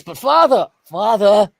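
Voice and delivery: lightly voiced pleading to father; a hint of a whiny kid begging dad; mock pleading child. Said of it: "I'm saying, Oh my goodness".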